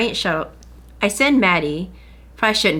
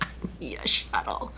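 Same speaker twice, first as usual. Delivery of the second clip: whispered plea; 11 semitones lower, 12 dB quieter than usual. Retold or imitated. Desperate plea inside Walmart.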